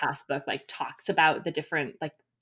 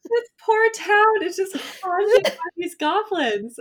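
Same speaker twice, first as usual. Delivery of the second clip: dramatic storyteller; 7 dB louder than usual. Acted.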